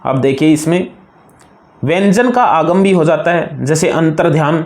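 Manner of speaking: ordinary talk, nothing marked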